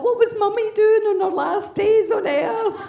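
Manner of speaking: put-on distraught kids. Grieving kids voiced.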